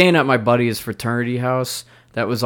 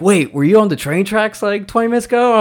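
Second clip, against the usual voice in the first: surprised question; 10 semitones higher, 5 dB louder than usual. Retold or imitated. Friends realize it was him.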